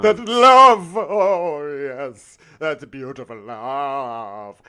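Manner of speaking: overblown, breathy-sounding gush then a slow drawn-out sigh; mock dramatic swoon. Gushing over the couple's love, hammed-up swoon. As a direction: ham it up like a gushing romantic. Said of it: "I really peaked hard there".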